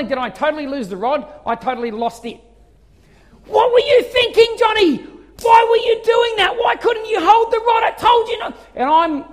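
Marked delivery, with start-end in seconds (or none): 3.5-8.6 high, loud furious yelling; furious dad yelling at his son; angry shout. Retold or imitated. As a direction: blast the boy with rage. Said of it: "I'm angry".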